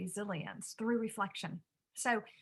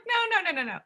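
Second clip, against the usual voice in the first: laughing protest; 10 semitones higher, 6 dB louder, faster than usual. Real, not acted. Mother laughingly corrects son.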